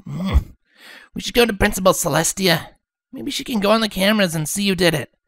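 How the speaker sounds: high, brisk tomboy voice; frowning Rainbow Dash. Acted. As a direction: push for a plan. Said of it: "Rainbow Dash said, frowning".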